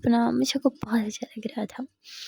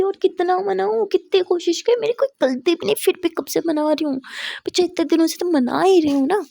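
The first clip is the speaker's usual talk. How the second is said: higher, louder frustrated pleading; exasperated; 7 semitones higher, 5 dB louder than usual. Acted. Misha, fed up, argues she has pleaded for days: exasperated cry.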